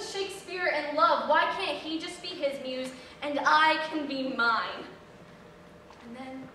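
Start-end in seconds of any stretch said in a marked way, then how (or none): none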